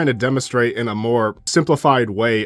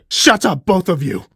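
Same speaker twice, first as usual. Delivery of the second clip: loud, raised-pitch silencing order; shouted command; 6 semitones higher, 4 dB louder than usual. Acted. Emperor silences both quarrelling governors, shouted command. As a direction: cut them both off, loud and high.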